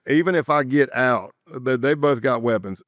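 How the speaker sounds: ordinary delivery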